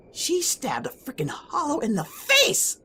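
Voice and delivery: high, breathless laughing amazement; excited. Acted. Telling a friend how his best friend saved him, excited and laughing. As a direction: gush about her, half laughing. Excited retelling of Sasha's bravery.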